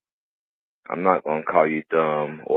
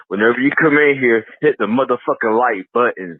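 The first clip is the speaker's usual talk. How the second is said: loud and higher, pumping up the viewers; hype shout; 7 semitones higher, 7 dB louder than usual. Real, not acted. Caller shouting at viewers to hit like, loud hype. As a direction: yell it like a hype man.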